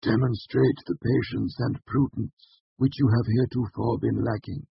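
high, measured, unemotional master; flat rebuke. A narrator's reading. Master archivist stating a condition to a student, flatly. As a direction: hand down the condition without feeling. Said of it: "Loren said flatly".

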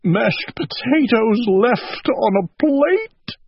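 high, slow tearful wail; crying. A narrator's reading. Owl cries over sad things, tearful wail. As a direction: draw the words out in a high mock sob. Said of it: "he cried".

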